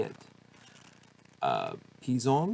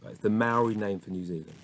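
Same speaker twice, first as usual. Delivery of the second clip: normal talking voice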